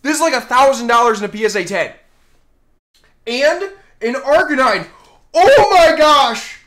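elated shouting; excited. Real, not acted. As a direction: still reeling from the pull, loud and high. Still elated after the pull.

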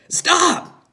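high, loud shout; father's alarmed, commanding yell; urgent order. A narrator's reading. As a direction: shout a sudden order to halt. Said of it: "his father ordered".

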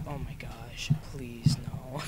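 low, soft mock pleading; hushed plea. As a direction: plead under your breath. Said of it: "that's a plead".

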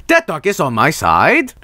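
sing-song delivery with "side" swung upward; odd inflection. Acted. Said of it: "Great inflection, [name], idiot".

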